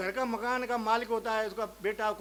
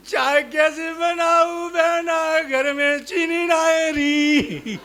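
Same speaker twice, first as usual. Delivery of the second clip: hammed-up keening about chores; mock wailing; 2 semitones higher, 7 dB louder, slower than usual. The sister wails back that there is no sugar. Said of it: "दूसरी कह रही".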